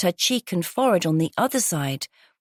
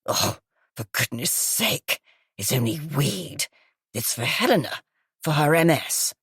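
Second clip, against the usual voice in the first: gravelly, smoky voice; 3 semitones lower than usual. Acted. Groggy guest exclaiming to police in a low smoky rasp. Croaky woman character voice.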